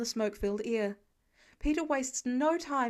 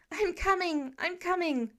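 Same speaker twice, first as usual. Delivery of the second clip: higher urgent call; 6 semitones higher than usual. A narrator's reading. Calling up to the woman.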